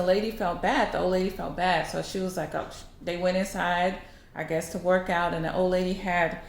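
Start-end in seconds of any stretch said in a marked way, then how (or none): none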